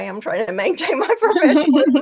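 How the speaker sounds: high, loud laughter breaking through the words; fighting off tears with a laugh. Real, not acted. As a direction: laugh while holding back tears. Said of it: "and I'm trying not to cry".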